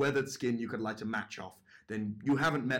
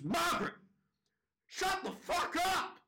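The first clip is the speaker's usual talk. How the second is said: very high, strained scream; retold scream. Drunk dad finally snapping at the judge, high mock scream. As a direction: snap and shriek it, drawing out each word. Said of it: "he snaps and he just screams".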